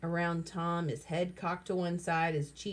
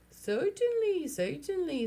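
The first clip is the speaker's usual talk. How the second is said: high, grand "certainly, certainly"; high, hammy gourmet voice; 10 semitones higher, slower than usual. A narrator's reading. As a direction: lord it over them like a gourmet. Billy plays the gourmet.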